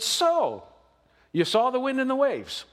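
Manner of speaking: playful upbeat Lord voice; cheery. Retold or imitated. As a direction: ask it lightly and cheerfully as the Lord. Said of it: "in a cheery way".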